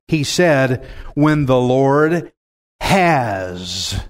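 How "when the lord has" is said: HAS set apart after a pause, long and low; slow reading, pausing before a drawn-out HAS; stress on HAS. Shown as a demonstration. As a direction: land heavily on HAS. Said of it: "you see that?".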